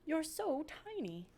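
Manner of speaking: high, soft and slow; tender princess voice. Acted. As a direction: coo softly at something tiny. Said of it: "said the princess".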